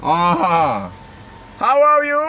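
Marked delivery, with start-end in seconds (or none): 1.6-2.2 a playful put-on voice the Melaka folk would grumble about; mock Melaka greeting. Streamer teasing Melaka viewers, high mock greeting. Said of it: "kalau ada orang Melaka dengar tu kata gitu".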